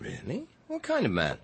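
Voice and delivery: a laugh under the question; teasing, laughing curiosity; amused. Acted. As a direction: ask it chuckling. Curious about a secret meeting.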